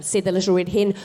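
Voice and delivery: ordinary delivery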